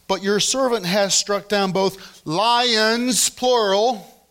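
"lions" and "plural" said slow and high with weight; plural stressed. Shown as a demonstration. Pastor stressing the plural to his church, slow and emphatic. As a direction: lean hard on "lions" and "plural". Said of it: "don't miss the S's here".

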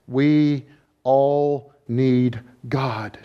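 ordinary delivery

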